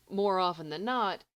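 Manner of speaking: usual speaking voice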